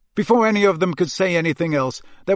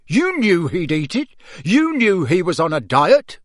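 higher, loud accusation; father's cross, raised voice; angry roar; 4 semitones higher, 5 dB louder than usual. A narrator's reading. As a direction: hammer home the accusation. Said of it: "roared Mister Weasley".

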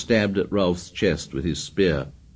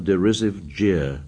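ordinary delivery, nothing marked

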